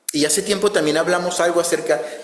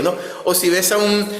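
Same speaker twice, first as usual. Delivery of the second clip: usual speaking voice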